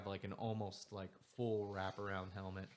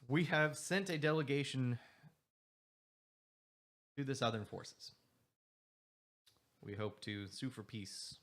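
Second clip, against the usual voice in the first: formal speech; 4 dB louder than usual. Acted. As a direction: address the hall solemnly. GM voices leader's formal speech.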